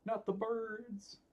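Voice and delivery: high, slow dramatic wail; mock despair. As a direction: ham up the dread, drawn out. Opponent plays the bird cards.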